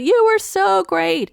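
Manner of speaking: high, slow call across the bar; mild mock yell from afar; retold yell. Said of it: "they yelled out".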